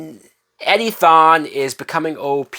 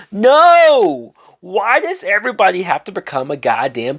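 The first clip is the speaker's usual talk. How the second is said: exasperated no; 5 semitones higher than usual. Real, not acted. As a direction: shout it down in disbelief. Chester asks to be superhero.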